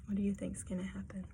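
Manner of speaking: fast, soft whisper to the listeners; whispered question. Real, not acted. Reader asks listeners a hushed question.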